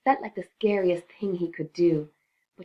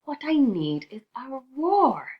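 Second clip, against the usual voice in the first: eager idea; 7 semitones higher, 4 dB louder than usual. A narrator's reading. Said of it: "held up a paw".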